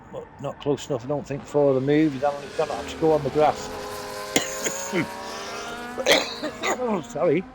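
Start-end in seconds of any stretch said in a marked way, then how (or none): 3.7-6.8 rough coughing fit; choking cough. Real, not acted. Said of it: "Choking on something there". 6.8-7.4 recovering from a coughing fit; choking. Real, not acted. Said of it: "Choking on something there".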